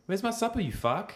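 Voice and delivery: put-on very high South African accent; Stephen Hudson. Copying the friend's quote of him, high South African accent. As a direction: squeeze the South African way up high, rising end. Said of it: "See, that was really good".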